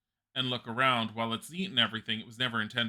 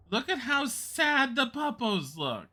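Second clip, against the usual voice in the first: much higher, slow pitying coo; 14 semitones higher, 4 dB louder, slower than usual. Real, not acted. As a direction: coo over the sad puppies. Cooing over sad wolves.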